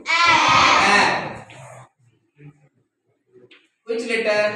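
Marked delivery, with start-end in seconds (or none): 0.1-1.5 loud, high drilled sound; high classroom shout; full pronunciation. Shown as a demonstration. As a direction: call the sound out fully for the class. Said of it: "pronounce it fully".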